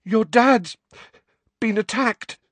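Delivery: high, breathless, urgent warning; sick boy panting out the news. Acted. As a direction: gasp it out to his friend. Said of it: "he panted".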